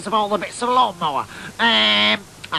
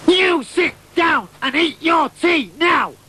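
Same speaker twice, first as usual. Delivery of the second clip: high, loud, slow shouted command; mum's order; 8 semitones higher, 3 dB louder, slower than usual. Retold or imitated. Mother cutting off the chatter, shouting at son to eat. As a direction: bark it like a fed-up mother.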